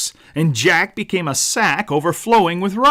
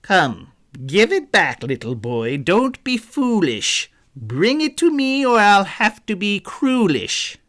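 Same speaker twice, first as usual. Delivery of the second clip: menacing thief; 6 semitones higher than usual. A narrator's reading. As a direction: coax then threaten like a storybook crook. Thief threatens the boy.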